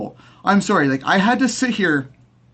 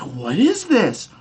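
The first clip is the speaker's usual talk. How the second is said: mock puzzled diggers; 5 semitones higher than usual. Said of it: "everyone's just like".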